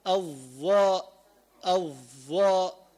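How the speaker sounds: الظاء drawn out slowly, tongue tip at the teeth; isolated ẓā' sound. Shown as a demonstration. Teacher demonstrates the ẓā' sound to students. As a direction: hold the ẓā' in الظاء long and slow. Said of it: "أما الظاء أين مخرجها".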